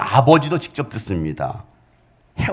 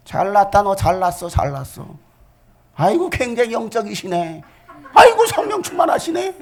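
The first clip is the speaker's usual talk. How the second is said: sarcastic mockery; 8 semitones higher than usual. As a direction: sneer at him with a laugh. (비꼬며 웃는 조롱)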